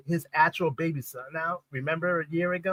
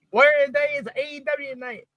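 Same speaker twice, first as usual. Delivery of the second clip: higher, sing-song announcer call; announcer hype voice; 9 semitones higher than usual. Retold or imitated. Announcing tonight is AEW night in a higher hype-man voice.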